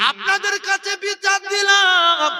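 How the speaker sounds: 2 high, slow, ringing plea to the crowd; 3 stretch it out like a cry for justice; 1 loud appeal. Real, not acted. 4 preacher appealing to the gathering, high and slow.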